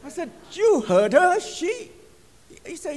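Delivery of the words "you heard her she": high, loud burst of disbelief; astonished question. Retold or imitated. Preacher asking the Swedish pastor about the woman, astonished. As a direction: blurt it out high, amazed.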